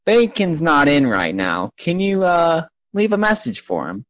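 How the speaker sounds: high-voiced receptionist; [name]. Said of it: "There is a human woman sitting at the desk".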